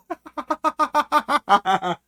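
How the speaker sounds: high rapid giggle; helpless string of chuckles; cracking up. Real, not acted. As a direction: burst out laughing mid-read. Cracks up while reading message.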